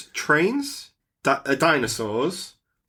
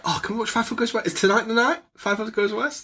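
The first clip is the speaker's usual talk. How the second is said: put-on high, fast, pleading kid voice; eager begging; 8 semitones higher than usual. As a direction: beg eagerly like an excited child. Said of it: "I was like".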